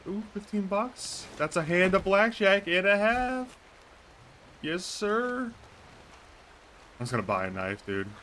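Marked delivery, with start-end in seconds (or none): 0.1-5.5 delighted. Real, not acted. Finds money in game.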